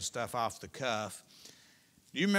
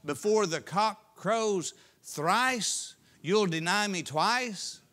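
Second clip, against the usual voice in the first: slow, higher, louder solemn prophecy; voicing Jesus; 7 semitones higher, 7 dB louder, much slower than usual. Preacher voicing Jesus' warning, slow and solemn. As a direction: draw out each word like a prophet.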